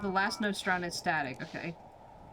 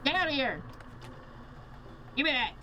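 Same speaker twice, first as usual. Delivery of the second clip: higher-pitched scolding; exasperated shooing; 5 semitones higher, faster than usual. Real, not acted. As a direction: chase off a pesky intruder. Shooing off whoever knocked coffee.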